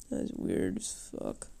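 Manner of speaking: unvoiced muttered whisper; whispered aside. Real, not acted. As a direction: whisper it under your breath.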